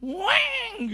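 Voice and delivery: loud, high drawn-out siren blare; siren wail. Retold or imitated. Pastor showing the congregation how the siren sounded, loud siren wail. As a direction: wail "weng" like an air-raid siren. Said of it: "bunyi sirine ya".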